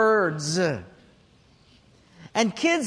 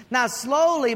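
ordinary delivery

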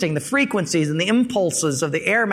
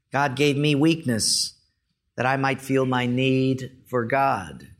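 solemn prayer reading; 3 semitones lower than usual. Acted. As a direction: read it like a sacred poem, line by line. Reads soldier's prayer aloud.

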